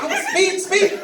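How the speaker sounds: high-pitched mock amazement; delighted driver. Driver understanding the cop's doubled word, high mock delight. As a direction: echo it back high and thrilled. Acted driver reacts to the cop.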